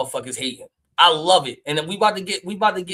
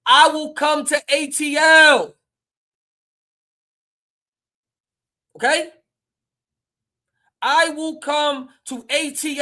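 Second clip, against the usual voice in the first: high, loud, drawn-out declaration; emphatic call-out; 11 semitones higher, 7 dB louder than usual. Real, not acted. Streamer telling Kenny he will come to A T L, emphatic call-out. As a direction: call him out loud and high, stretching the words.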